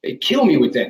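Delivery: ordinary talk, nothing marked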